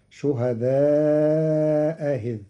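slow, with the second hamza of شهداء إذ eased toward a vowel; softened hamza. Shown as a demonstration. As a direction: glide gently over the hamza in إذ. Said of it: "بتسهيل الهمزة الثانية تخفيفا، فتصير".

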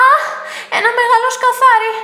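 loud, high startled cry; frightened shriek. A narrator's reading. Anna waking to a beetle on her arm, startled shriek. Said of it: "φώναξε τεινάζοντάς το".

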